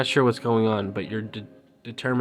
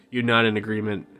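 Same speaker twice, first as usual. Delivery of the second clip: ordinary delivery, nothing marked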